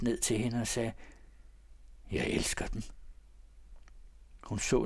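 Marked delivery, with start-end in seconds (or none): 2.1-2.8 breathless whispered confession; whispered love. A narrator's reading. Count leaning to her carriage, whispering his love. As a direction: lean close and whisper it.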